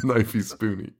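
breaking into laughter on the words; cracking up while quoting a cartoon line; laughing quote. Real, not acted. Quotes Simpsons gag while laughing hard.

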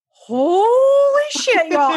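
very high, loud and drawn out slowly; retold shriek of amazement, very high and slow; stunned exclaim. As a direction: stretch it out in full shock. Retelling shock in hotel room.

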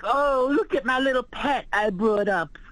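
high, put-on coo of a condescending liberal; mocking liberal voice. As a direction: sneer it like you're talking down to a pet. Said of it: "It's like".

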